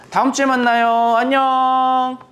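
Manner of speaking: high, bright sing-song farewell, drawing out the last word; cheerful goodbye. Real, not acted. Teacher says goodbye to the children until next week, cheerful high sing-song.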